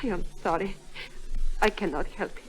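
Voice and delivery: quiet, tearful apology. Acted. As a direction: hold back the tears as you speak. Alicia apologizes while crying.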